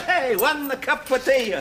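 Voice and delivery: slow, high mimicry of a put-on Italian accent; mock Italian accent mimicking a friend's cafe order. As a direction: parrot his Italian-accented order back at him, teasing. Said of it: "You used to talk broad Cockney at school".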